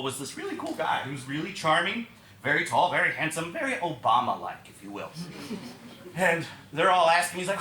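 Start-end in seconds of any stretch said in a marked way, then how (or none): none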